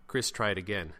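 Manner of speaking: ordinary delivery, nothing marked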